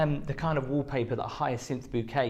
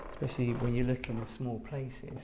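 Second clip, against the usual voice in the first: ordinary delivery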